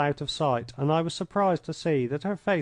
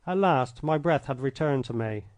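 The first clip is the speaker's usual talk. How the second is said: ordinary delivery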